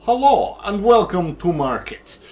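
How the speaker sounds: high, loud, hammed-up Russian accent; badly faked Russian welcome, slowing after the hello; terrible Russian accent. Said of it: "my terrible Russian accent voice".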